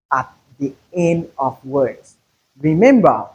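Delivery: ordinary talk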